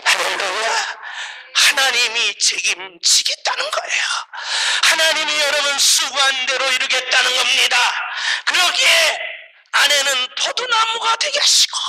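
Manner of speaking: loud, high pulpit shout; fired-up preacher's cry; preaching at full force. Real, not acted. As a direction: proclaim it forcefully to the congregation.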